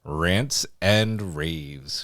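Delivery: slow announcer. Shown as a demonstration. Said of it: "Let's get into some".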